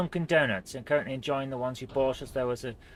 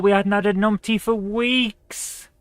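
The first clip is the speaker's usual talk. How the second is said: building to a loud, high wail; slow start, rising into a comic moan; exasperated lament; 5 semitones higher, 6 dB louder than usual. Real, not acted. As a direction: draw out the last word in dismay. Laments his misclick after a good run.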